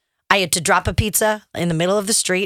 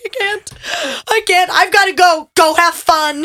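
higher, louder, breaking up; retold crying outburst; 9 semitones higher, 5 dB louder than usual. Wife telling husband on the phone she can't cope, near tears. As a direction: sound close to tears as you hang up. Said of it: "and then I just started crying".

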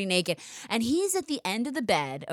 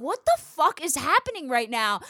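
bewildered protest; 6 semitones higher, 3 dB louder than usual. Retold or imitated. Retold panic while tied up.

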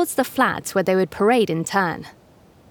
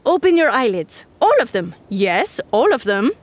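stern camel, high and loud; crisp disapproving governess; 3 semitones higher, 3 dB louder than usual. Acted. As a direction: order it like a strict governess. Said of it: "she said sternly".

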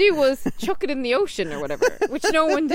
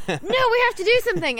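higher-pitched mock indignation; imitated outrage; 7 semitones higher than usual. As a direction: protest urgently, lightly acted. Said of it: "he was, like, really enraged".

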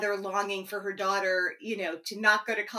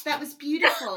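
laughing; 7 semitones higher, 9 dB louder than usual. Real, not acted. Host laughing praise.

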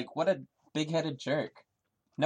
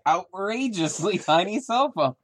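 high, loud, delighted; gleeful repeat; 7 semitones higher, 5 dB louder than usual. Acted. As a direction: savor every word with glee. Repeating favorite line with gusto.

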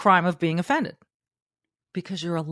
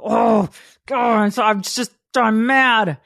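higher, louder hammed-up fuming; mock angry reader; 5 semitones higher, 5 dB louder, slower than usual. Imitating a reader offended online, mock fuming. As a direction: play a reader boiling over. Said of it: "you go".

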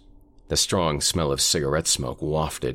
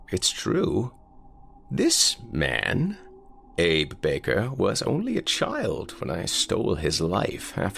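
put-on old man voice; 3 semitones higher than usual. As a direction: croak it like a frail old man.

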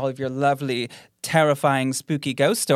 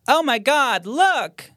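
higher, louder alarmed ghost cry; 10 semitones higher, 4 dB louder, slower than usual. Shown as a demonstration. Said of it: "Is it A".

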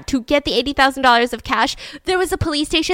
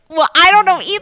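high, loud mock yell; exasperated retort; 9 semitones higher, 9 dB louder than usual. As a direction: throw it back in hammed-up frustration. Frustrated customer retorts.

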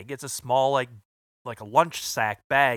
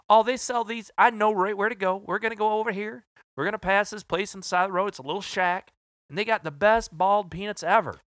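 higher, quick Southern drawl, eager; 7 semitones higher than usual. Retold or imitated. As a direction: rattle it off in an excited Southern accent. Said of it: "he's got that southern drawl".

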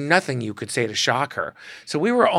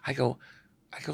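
ordinary talk, nothing marked

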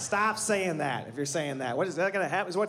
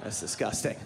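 low, disgusted bro; lower dude voice; 7 semitones lower, 3 dB quieter than usual. Retold or imitated. Friend scolding comic about married sex, low and disgusted. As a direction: drop low, grossed-out buddy. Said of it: "They're like".

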